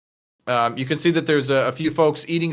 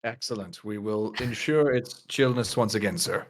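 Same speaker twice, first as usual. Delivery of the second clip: lower, softer, lightly formal server voice; obliging server; 4 semitones lower, 4 dB quieter than usual. Acted. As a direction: quietly assure a fussy customer. Server formally takes drink order.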